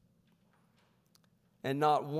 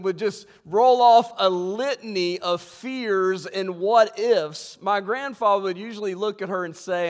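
ordinary talk, nothing marked

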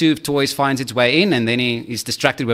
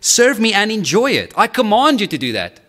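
commanding God; 7 semitones higher than usual. Acted. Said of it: "He says".

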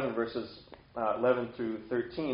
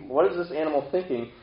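ordinary delivery

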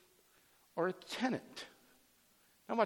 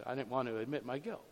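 ordinary talk, nothing marked

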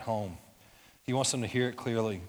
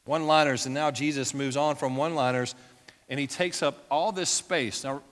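normal talking voice

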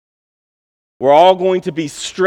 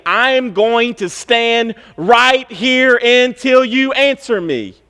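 slow, raised, deliberate vow with long pauses; Habakkuk's vow; 6 semitones higher, slower than usual. Acted. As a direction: plant your feet, spacing out each word. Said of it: "And Habakkuk is saying".

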